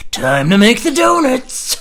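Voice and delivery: high, slow, drawn-out cry; hammed-up slow holler; absurd climactic announcement.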